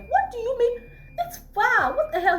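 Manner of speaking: mock outraged scream. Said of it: "I literally screamed".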